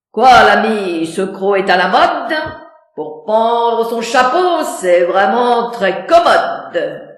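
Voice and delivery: loud, offhand swagger; breezy, cocky jab; cavalier. Acted.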